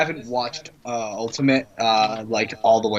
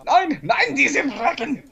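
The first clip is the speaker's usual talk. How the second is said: put-on German accent; 10 semitones higher, slower than usual. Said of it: "Your most stereotypical German accent".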